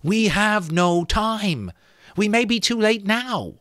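Molly begging the skull for help; desperate plea. A narrator's reading. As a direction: plead straight into its empty eyes. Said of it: "appealing directly to its uninhabited eyes".

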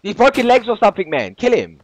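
high, loud excited callouts; man down shout. Real, not acted. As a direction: yell the enemy status. Urgent callouts in firefight.

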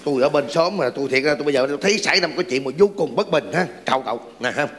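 gruff neighbour voice. Acted. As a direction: play the indignant guy from next door.

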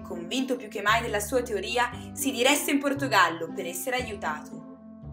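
ordinary delivery, nothing marked

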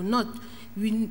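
ordinary talk

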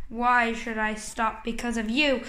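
low, menacing monster. Acted. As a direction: rumble it like a big beast. Giant answers the heroes.

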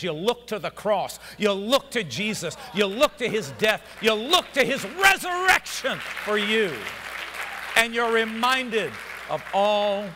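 loud pulpit shout; shouted preaching. Acted. Preacher urging the church to look to Jesus, shouting. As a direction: hammer each line home.

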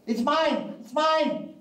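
put-on high-pitched squabbling toddler shout. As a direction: yell it like a two-year-old fighting over a toy. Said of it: "So they're shouting".

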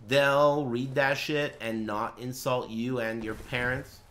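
usual speaking voice

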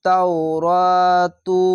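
তাওরাতু very slow, vowel stretched; drawn recitation. Shown as a demonstration. Teacher demonstrates elongation to students, slow recitation. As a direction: stretch তাওরাতু long and steady. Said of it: "খার জবর এক আলিফ টেনে পড়বেন".

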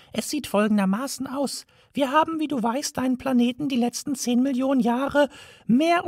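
high, tiny mouse voice, matter-of-fact; a mouse laying out the plan; high mouse voice, explaining. A narrator's reading.

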